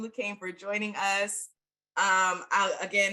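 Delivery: ordinary delivery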